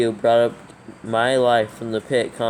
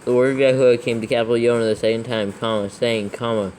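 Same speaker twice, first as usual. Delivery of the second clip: dictating; 4 dB louder than usual. Acted. Dictating verse with punctuation.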